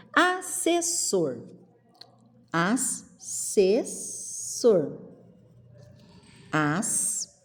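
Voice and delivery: long gaps, as if dictating; syllable by syllable. Shown as a demonstration. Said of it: "Viu como ficou?".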